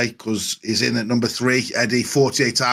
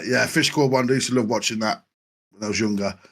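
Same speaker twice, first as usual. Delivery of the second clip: usual speaking voice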